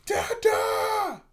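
put-on very high, loud toddler yell. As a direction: imitate a little kid calling out for Dada. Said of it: "likes to yell for people".

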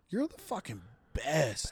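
slow build to a punched "best"; emphasis on best. Shown as a demonstration. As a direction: draw it out and land on the B of "best". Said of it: "the little emphasis on the B".

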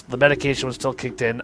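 usual speaking voice

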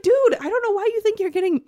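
high, loud, incredulous outburst; outraged retort. Retold or imitated. Reader retelling telling off the husband, outraged disbelief.

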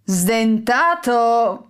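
slow, drawn-out villain's sneer. A narrator's reading. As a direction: stretch the name with a nasty grin. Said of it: "disse da un ghigno orrendo". (ghigno cattivo e lento)

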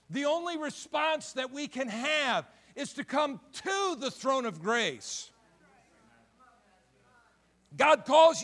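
loud, high-pitched preaching at full force; fiery pulpit preaching. Acted. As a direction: preach it out to the congregation.

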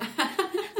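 short high giggle; a giggle escaping; stifled giggles. Real, not acted. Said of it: "No te rías".

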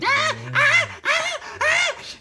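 high, loud chimp-like whooping shouts; imitated chimp cries. Friend imitates chimps celebrating their kill with shrieking whoops. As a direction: screech like chimps cheering a kill, laughing through it. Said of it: "y gritan, celebran los chimpancés".

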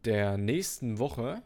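ordinary talk

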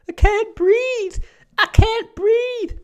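very high, drawn-out Californian shriek; loud, squealed impression of a panicked American; mock hysterical scream. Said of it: "Screaming at the top of her lungs".

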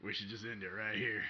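put-on soft, tired old man voice. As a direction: sigh it out like a worn-out old man. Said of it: "turned into my old man voice".

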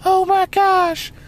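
put-on very high, slow squealing girl; shocked girl voice. Friend Annie freaking out on the phone, high squeal. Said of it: "She's like".

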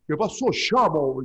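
slow, drawn-out imitation of Venezuelan speech; mock Venezuelan accent. Coworkers mocking how Venezuelans talk, in a slow Venezuelan accent. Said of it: "como me imitaban".

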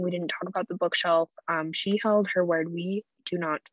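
ordinary delivery

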